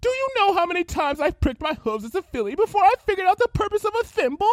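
fast, loud, high tirade; fast angry rant. Acted. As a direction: rattle off the grievance furiously. Rarity ranting.

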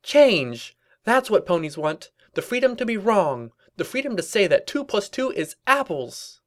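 high, impassioned slogan-making; zealous revolutionary pony. Acted. Rebel demanding change, high zealous proclamation. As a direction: proclaim it like a rally speech.

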